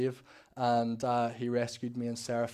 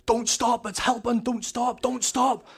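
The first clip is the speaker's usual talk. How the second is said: high, raised imitation of a desperate plea; urgent pleading cry; 11 semitones higher, 6 dB louder, slower than usual. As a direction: act out begging them to keep going, pitched right up. Brother's urgent plea during prayer, acted.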